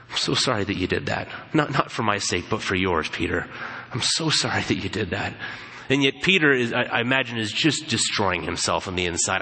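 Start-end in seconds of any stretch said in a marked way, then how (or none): 0.1-5.3 lower, softer, gentle sorrow; tender pity. Retold or imitated. Jesus gently speaking to Peter with pity. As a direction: look at him with pity.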